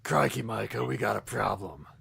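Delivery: low, dragged-out tough-guy voice; Punisher. Pretend. Co-host imagines the Punisher saying crikey, mock tough-guy voice. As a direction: drop the pitch and play it hard-boiled. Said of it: "Imagine the Punisher saying".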